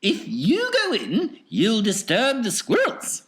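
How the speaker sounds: high, lively showman voice; Wonka's high, bouncy showman voice; eccentric chocolatier. Acted. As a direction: play the excitable factory owner, pitched well up. Wonka warning children.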